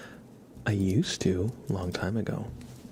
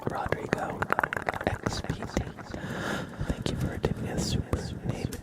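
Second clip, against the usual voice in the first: whispered letter by letter; ASMR whisper; 3 dB louder than usual. Acted. Tracing a viewer's name letter by letter, whisper.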